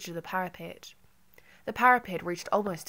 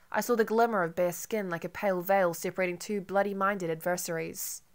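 ordinary delivery

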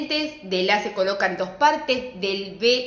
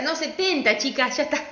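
normal talking voice

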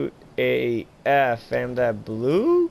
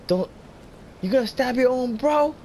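very high, loud shocked cries; shocked shriek; 4 dB louder than usual. Real, not acted. Streamer shrieking as the ally gets stabbed, very high. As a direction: shriek at the betrayal on screen.

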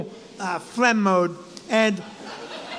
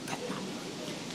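slow, voiceless whisper; secretive hushed repeat; inaudible whisper; 19 dB quieter, slower than usual. Retold or imitated. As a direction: whisper so faintly your friend can't hear. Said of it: "And Barry can't hear me".

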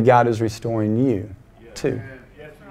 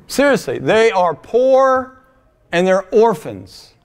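impassioned preaching; 6 semitones higher, 4 dB louder than usual. Acted. Preacher pressing congregation to help orphans, impassioned. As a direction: plead the cause with full fervor.

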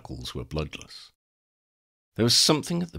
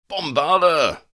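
loud, higher bellow; bellowed spell; 10 semitones higher, 7 dB louder, slower than usual. Acted. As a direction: bellow it like an attack. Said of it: "Ron bellowed".

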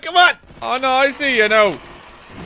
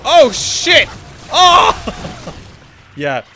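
normal talking voice

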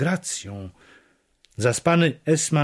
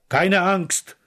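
raised, high call in reply; shouted back; 7 semitones higher than usual. A narrator's reading. Henri answering from the yard, shouted back. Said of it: "odkrzyknął mi już z dworu".

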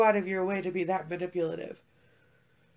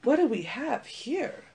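intrigued ooh; 4 semitones higher than usual. Retold or imitated. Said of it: "I was like".

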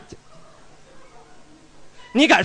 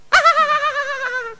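shrill eunuch cackle. Acted. Eunuch character laughs.

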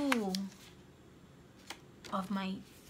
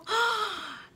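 delighted gasp; 10 semitones higher than usual. Real, not acted. Opening card inside.

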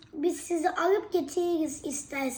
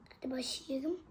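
hushed whispered prompt; 5 dB quieter than usual. Real, not acted. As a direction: whisper it to mother.

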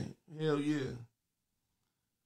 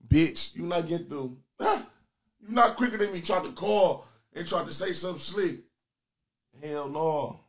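ordinary talk, nothing marked

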